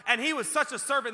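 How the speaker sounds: loud, high declaration; preaching shout. Real, not acted. Preacher exalting Nehemiah's service, loud and high. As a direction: hammer it home loud.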